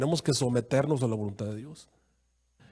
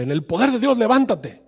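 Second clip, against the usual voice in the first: hammed-up healer's command, higher and louder; mock faith healer; 6 semitones higher, 4 dB louder than usual. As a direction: mimic a showy preacher ordering the man to rise. Imita a pastor sanador. (imitación burlona de un pastor sanador, más agudo)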